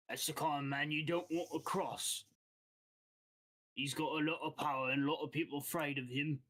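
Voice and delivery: lightly voiced guild boss with an accent; wary crime boss in a put-on accent. Game master voices guild boss.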